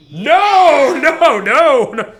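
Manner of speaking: hammed-up angry refusal; mock shout. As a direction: shout it like an offended man. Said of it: "They get so mad".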